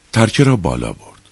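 ordinary delivery

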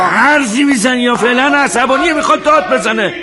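high, exasperated outburst. Acted. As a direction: fret loudly, pitch held high.

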